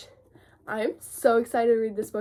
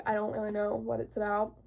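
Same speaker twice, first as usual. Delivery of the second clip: ordinary talk, nothing marked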